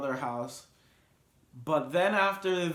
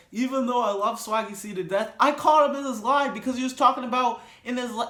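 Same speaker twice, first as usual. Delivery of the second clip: fast, loud and much higher, rushing it out; excited outburst; 7 semitones higher, 7 dB louder, faster than usual. Real, not acted. Vlogger excitedly tells viewers how he called out a lie. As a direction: blurt it out like you can't hold it in.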